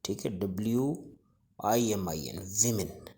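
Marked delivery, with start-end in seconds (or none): 2.4-3.1 विमेन with short I, "wi-min"; correct pronunciation. Shown as a demonstration. Teacher repeating the word after spelling it, correct pronunciation. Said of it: "correct pronunciation है वो विमेन".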